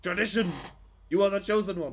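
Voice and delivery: high, loud parody of film dialogue; mock proclamation. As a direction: proclaim it loudly, mocking the melodrama. Mimicking the film's dialogue just played.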